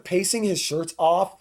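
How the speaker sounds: ordinary talk, nothing marked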